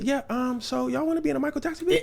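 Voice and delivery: high voice, hesitant start then rushed question; awkward recruiter. Retold or imitated. Storyteller as the star's staff approaching gangsters, high awkward asking. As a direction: pitch it up and hurry out the invitation.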